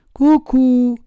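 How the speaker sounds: high sing-song cuckoo jeer; mocking taunt. A narrator's reading. Puppet teasing the boys who threaten him, mocking. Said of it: "in segno di canzonatura".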